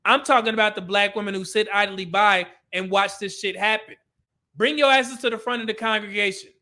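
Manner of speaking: ordinary delivery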